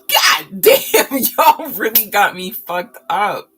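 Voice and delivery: loud, high comic outrage with laughter; mock exasperation. Streamer confused by viewers' conflicting words, mock-exasperated outburst. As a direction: blow up at them in fun.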